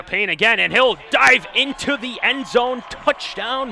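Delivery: rising excited shout; touchdown call. Real, not acted. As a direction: call the score with a roar.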